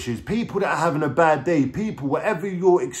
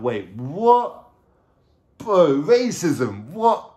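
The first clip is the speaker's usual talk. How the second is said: drawn-out, rising baffled questions; acted disbelief; 3 semitones higher, 4 dB louder, much slower than usual. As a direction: replay dawning disbelief, stretching the words. Sitting in jail, disbelieving the charge.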